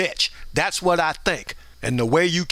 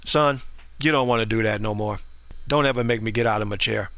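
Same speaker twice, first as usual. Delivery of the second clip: a lightly voiced stern grandfather, much lower; grandfather's low warning; 8 semitones lower than usual. Grandfather warns the grandson from his chair, low and stern. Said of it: "my grandfather was just like".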